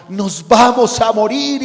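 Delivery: loud, high, slow cry of panic; panicked shout. Acted. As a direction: apostles screaming as the boat sinks. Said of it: "Gritaron, se desesperaron".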